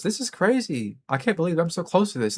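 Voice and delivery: mock tourist.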